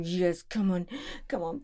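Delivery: ordinary delivery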